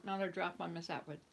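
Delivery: usual speaking voice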